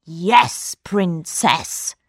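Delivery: sly magician. A narrator's reading. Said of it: "said the magician".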